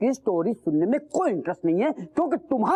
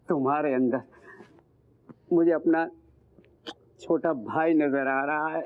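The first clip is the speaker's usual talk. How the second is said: low, tender voice with long pauses; heartfelt gratitude; 7 semitones lower than usual. Acted. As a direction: confide warmly, lingering between phrases. Gratefully praises him as younger brother.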